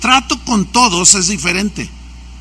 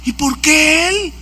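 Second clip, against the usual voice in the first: higher, drawn-out envious question; mock jealous protest; 7 semitones higher, slower than usual. Overlooked supporter watches the president embrace another, mock jealous protest. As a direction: act out a slow, indignant complaint. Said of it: "y tú dices".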